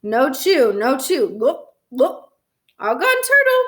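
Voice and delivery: put-on baby talk rising to a high, proud finish; babbling. A narrator's reading. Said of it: "Fudge babbled".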